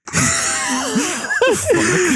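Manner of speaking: real high squealing laugh; shrieking laugh. Real, not acted. Podcaster cracking up at own story, shrieking laugh. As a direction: burst into a screaming laugh.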